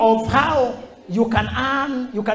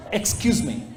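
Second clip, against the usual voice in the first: firm brush-off. Shown as a demonstration. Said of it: "this is how I execute".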